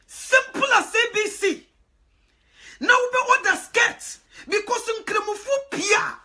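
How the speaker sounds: high, loud, slow proclamation; slow, ringing declaration; preaching climax. Real, not acted. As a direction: proclaim it like the peak of a sermon.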